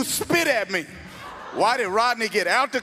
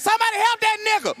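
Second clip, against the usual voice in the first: blurted call for help; 11 semitones higher, 3 dB louder than usual. Retold or imitated. Shouting out in church.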